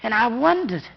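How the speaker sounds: plain reading voice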